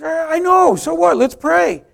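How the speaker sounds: high, loud acted brush-off; brisk dismissal. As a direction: wave off his fear loudly. Friend brushing off fear, acted.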